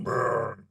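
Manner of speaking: slow rolling growl; creature growl. Shown as a demonstration. Voice actor showing his Bowser sound, drawn-out growl. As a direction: growl like a video game monster. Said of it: "this thing I'm able to do".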